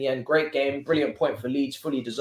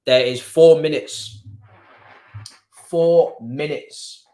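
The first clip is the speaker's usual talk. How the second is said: slow, loud, higher-pitched call; drawn-out announcement; 3 semitones higher, 8 dB louder, much slower than usual. Real, not acted. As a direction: stretch every word out loudly. Announcing the minutes left, drawn out.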